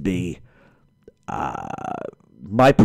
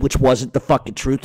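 ordinary talk

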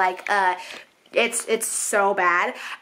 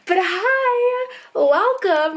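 high, bright hello; excited greeting; 9 semitones higher, much slower than usual. Real, not acted. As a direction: greet them with a big lift.

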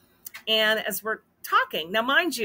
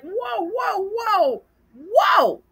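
high, rising in volume to a loud last cry; startled halting; 12 semitones higher, 7 dB louder, much slower than usual. Retold or imitated. Wife halts angry husband on the phone, startled protest. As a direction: cut them off, taken aback, high and insistent.

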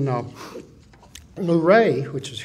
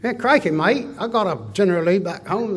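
mock New Zealand accent; 3 semitones higher, 3 dB louder than usual. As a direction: play a cheerful New Zealand fan. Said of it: "got people showing up from New Zealand".

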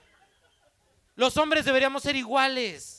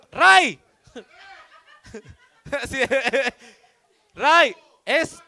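calling out; 3 semitones higher, 8 dB louder, much slower than usual. Real, not acted. Calling out name.

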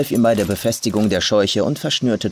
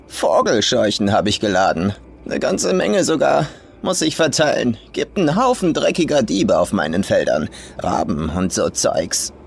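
put-on rough old farm woman. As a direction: sneer it as the crude old farmer.

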